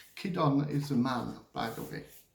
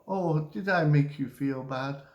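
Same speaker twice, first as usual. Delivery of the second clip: ordinary talk, nothing marked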